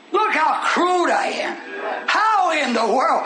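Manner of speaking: slow, crying preacher's shout; preaching outcry. Real, not acted. Preacher showing his people how crude he is, slow crying shout. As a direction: cry it out slow.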